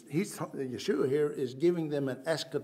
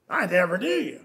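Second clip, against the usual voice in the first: stern rejection; 8 dB louder than usual. Acted. Voicing Christ rejecting.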